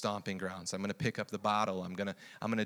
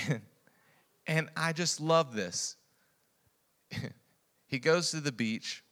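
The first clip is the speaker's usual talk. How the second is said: ordinary talk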